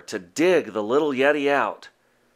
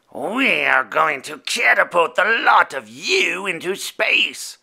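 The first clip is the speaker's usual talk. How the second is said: shouted; 4 semitones higher than usual. A narrator's reading. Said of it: "shouted one".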